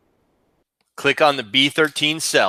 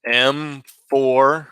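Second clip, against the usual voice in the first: ordinary talk, nothing marked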